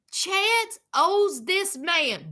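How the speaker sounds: ordinary talk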